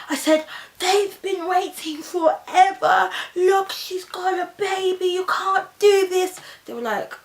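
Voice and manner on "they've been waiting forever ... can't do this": high, drawn-out pleading protest; high, slow, dramatic outcry; acted protest. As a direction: plead loudly on someone else's behalf. Acting out her protest to staff.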